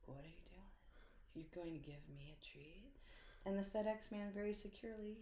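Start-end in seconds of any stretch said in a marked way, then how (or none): 0.1-2.9 dog voice. Pretend. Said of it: "she was looking at him like".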